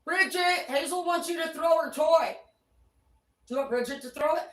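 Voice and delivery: loud, high call across the room; calling the dog. Real, not acted. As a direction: call out to Bridget so she hears. Calling to the dogs.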